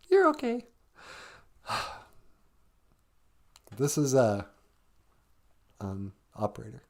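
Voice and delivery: ordinary talk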